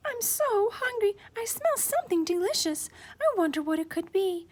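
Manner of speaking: put-on very high little-girl voice; little girl Goldilocks. A narrator's reading. Hungry Goldilocks smelling something delicious, high girlish voice.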